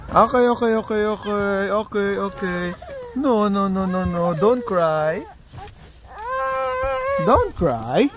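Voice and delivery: soothing, coaxing hush; reassuring daddy voice. Real, not acted. Father comforting crying child.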